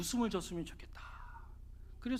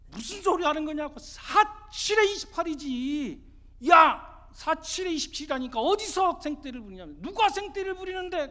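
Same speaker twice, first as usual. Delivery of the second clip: high, loud angry shouting match; exasperated quarrel; 8 semitones higher, 9 dB louder than usual. Acted. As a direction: argue as if about to burst. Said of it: "속이 막 터지는 거예요". (속 터져서 언성 높여 싸우기)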